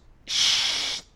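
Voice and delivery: long drawn-out "shhh" hiss; shushing. Shown as a demonstration. Teacher demonstrating a hush to show lip position, long shushing hiss. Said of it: "静かにして".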